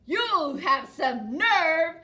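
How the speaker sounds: loud, slow roaring troll. Acted. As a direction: roar at the little goat like a grumpy troll. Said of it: "roared the troll".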